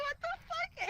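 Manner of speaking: high, aghast re-enactment; shocked disbelief. Retold or imitated. Reacting to friend punching Meredith, shocked. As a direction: squeak it in mock horror.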